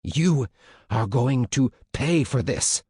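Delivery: enraged officer, high start, slow threat; winded, furious, word by word; out-of-breath threat. A narrator's reading. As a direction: threaten between efforts, loudly. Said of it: "He sounded out of breath himself".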